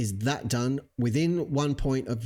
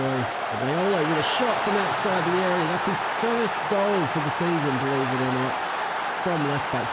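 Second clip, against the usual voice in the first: excited goal call; 5 semitones higher, 3 dB louder than usual. Real, not acted. Goal celebration commentary.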